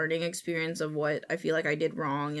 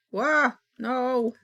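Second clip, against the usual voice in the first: loud alarmed yelp; horrified surprise; 5 semitones higher, 9 dB louder than usual. Real, not acted. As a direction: cry out in sudden dismay. Reacts to a rigging mishap.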